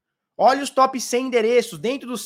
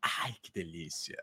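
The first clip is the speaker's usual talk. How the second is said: very low, slow, gloating growl; whale voice; 18 dB quieter than usual. Pretend. Whale mocking the panicking small investor, deep and slow. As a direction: savor it like a satisfied monster. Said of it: "a baleia fala assim".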